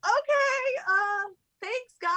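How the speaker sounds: high-pitched, uneasy politeness; awkward reaction. Retold or imitated. Replaying her thanks to the odd couple, high and awkward.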